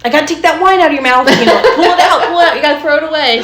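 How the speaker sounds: high, loud, rushed mock urgency; silly, high-pitched fussing at a child; playful humor. Shown as a demonstration. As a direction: play it up fast to make a kid laugh. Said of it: "I like to, like, incorporate humor".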